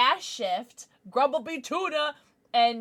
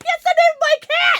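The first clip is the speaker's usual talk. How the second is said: excited quote; 10 dB louder than usual. Retold or imitated. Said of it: "came to chat and was like".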